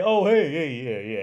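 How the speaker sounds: loud, high cheerful hello; mock friendly greeting. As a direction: greet them like a stranger you barely understand. Mock greeting to host family.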